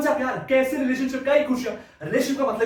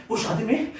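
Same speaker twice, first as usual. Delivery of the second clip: ordinary talk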